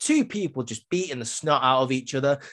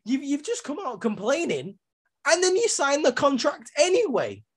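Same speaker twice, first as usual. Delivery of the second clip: much higher, incredulous rant; high-pitched bewildered rant at the wrestlers; exasperated disbelief. Retold or imitated. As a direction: squeak out your disbelief at the screen. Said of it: "And I'm like".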